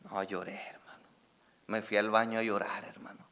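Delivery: whispered, almost breaking. Real, not acted. Pastor whispering to congregation that he cried. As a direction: whisper it like a confession. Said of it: "whispered confession of crying".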